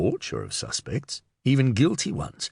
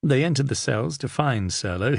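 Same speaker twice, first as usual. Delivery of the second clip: ordinary delivery, nothing marked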